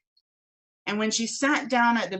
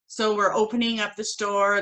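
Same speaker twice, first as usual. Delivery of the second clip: ordinary delivery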